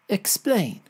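usual speaking voice

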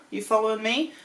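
ordinary delivery

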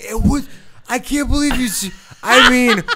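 put-on high, loud drunken amazement; building to a loud, laughing mock awe; overwhelmed drunk. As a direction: imitate a drunk who can't get over it. Drunk man overcome with amazement, retold.

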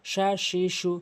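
ordinary delivery

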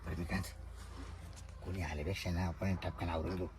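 low, soft, secretive murmur; furtive undertone. Acted. Urging the visitor to finish before anyone comes, furtive undertone. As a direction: keep it down, for one listener only. Furtive proposal after asking for hush.

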